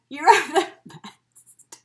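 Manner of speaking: slow, laughing through the words; laughing thanks. Real, not acted. Thanking friend Angie, laughing through the words. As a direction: laugh while thanking her.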